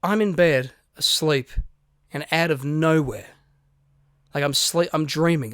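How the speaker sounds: normal talking voice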